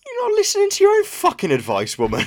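very high, loud mock outburst; dad piping up. Dad interrupting mum's decluttering advice, mock outburst. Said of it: "he kind of pipes up".

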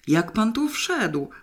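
high, drawn-out despairing question; anguished demand without much extra volume; desperate cry. A narrator's reading. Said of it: "wrzasnął rozpaczliwie".

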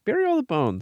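very high, sing-song command to the dog; mock order. Owner telling Peaches to hide evidence, high sing-song. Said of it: "you're like".

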